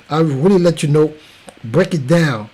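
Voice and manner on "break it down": usual speaking voice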